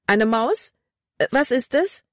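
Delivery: high startled question; a girl squeaks in fright at a mouse; frightened cry. A narrator's reading. Girl in the dark startled by a mouse, high frightened. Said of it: "Libby schrie auf".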